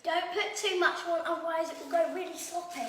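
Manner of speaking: calling out a firm warning; loud warning. Real, not acted. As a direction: say it loud, bossy and sure. Child warns about egg wash.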